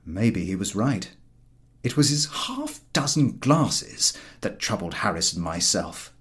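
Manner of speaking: ordinary delivery, nothing marked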